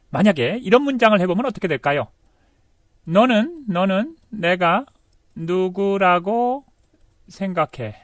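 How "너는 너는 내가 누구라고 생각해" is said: feed the sentence to learners bit by bit; slow dictated sentence. Shown as a demonstration. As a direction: piece by piece with pauses. Dictating sentence to translate slowly.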